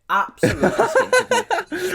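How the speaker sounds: loud, high, laughing outburst; laughing exclaim. Real, not acted. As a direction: blurt it out through a laugh. Repeats phrase, second time emphatic.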